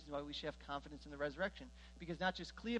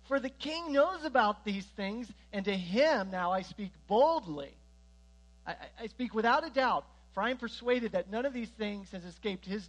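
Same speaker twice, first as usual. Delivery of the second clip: bold defiant Paul; 3 semitones higher, 8 dB louder than usual. Acted. Paul on trial answering Festus, loud and bold. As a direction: declare it boldly, voice raised. Paul appeals to King Agrippa.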